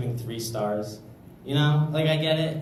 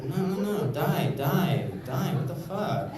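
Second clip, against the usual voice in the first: higher, slow, hammed-up protest; mock protest; 6 semitones higher than usual. Comic imitating settlers shouting stop, hammed-up. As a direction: wave them off in mock alarm. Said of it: "we were just like".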